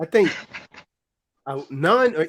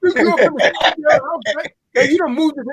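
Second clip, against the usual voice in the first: high incredulous; 9 semitones higher than usual. Retold or imitated. Retold scolding of brother.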